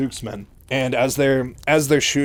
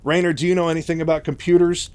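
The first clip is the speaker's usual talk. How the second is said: higher, louder, mock-flustered question; mild panic; 6 semitones higher, 6 dB louder than usual. Mike asks Raynor for computer help, mildly panicked. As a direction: play a brief flash of panic. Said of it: "just kind of panics for a second".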